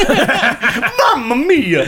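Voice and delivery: high, stretched Italian plumber cry; Mario voice. Acted. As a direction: belt it out like a cartoon Italian plumber. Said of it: "Chris Pratt doing the Mario voice".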